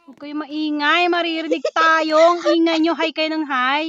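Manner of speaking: loud, annoyed scolding. Real, not acted. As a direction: hush them loudly, fed up with the noise. Scolding noisy playmate during hiding game.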